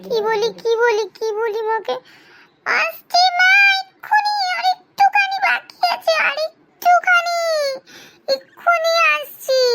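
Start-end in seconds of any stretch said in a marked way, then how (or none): 2.8-9.6 high pleading call from the toilet; calling out to mother. Acted. Child stalling, calling out to mother. (মাকে উঁচু গলায় অনুনয় করে ডাকা)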